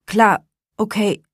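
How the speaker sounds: strained, squeezed out in pain; hit in the groin. A narrator's reading. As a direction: force the words out through pain. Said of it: "presste er hervor".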